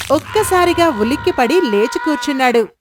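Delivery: ordinary delivery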